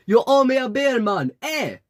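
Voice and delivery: put-on high Jamaican-accented yell; shouted demand. A narrator's reading. Fake Jamaican yelling after the narrator about beer, high shout. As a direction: holler it after someone leaving with your money. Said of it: "he shouted after me".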